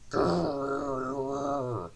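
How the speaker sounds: long rolling growl; engine roar. A narrator's reading. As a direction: roar out a drawn "grrr". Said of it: "roared Diesel".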